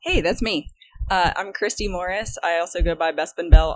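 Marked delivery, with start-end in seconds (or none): none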